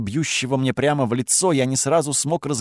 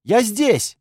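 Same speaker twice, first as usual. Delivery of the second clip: high, loud call across the cave; 9 semitones higher, 3 dB louder, slower than usual. A narrator's reading. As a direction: call him over urgently. Girl calling from next hall.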